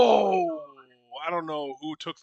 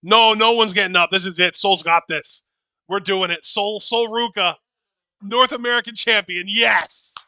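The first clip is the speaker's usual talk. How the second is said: triumphant chant, much louder; loud cheering; 2 semitones higher, 10 dB louder than usual. Real, not acted. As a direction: chant the champion's name in triumph. Title win cheered live.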